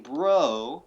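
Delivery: "bro" drawn out long and loud, as if two syllables; bro stretched out. Shown as a demonstration. Host arguing from Steven's pronunciation, "bro" stretched into two beats. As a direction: stretch the vowel of "bro" into a second beat. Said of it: "almost as if there's two syllables in the word".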